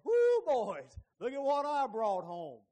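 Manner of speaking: very loud, high whooping brag; cocky showoff bragging. Retold or imitated. Man parading his woman before the men, loud crowing brag. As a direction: holler it out, proud of his prize. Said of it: "that's how I'd say it".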